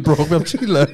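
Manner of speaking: normal talking voice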